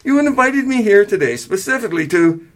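high-pitched, incredulous objection; indignant protest, cut off. A narrator's reading. Son argues with parents, indignant then stopping himself. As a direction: launch a high protest and break off. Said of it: "he stopped himself".

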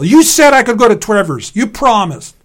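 high, loud wailing protest; child's wail. A narrator's reading. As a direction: wail it like a wronged little brother. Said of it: "He began to wail".

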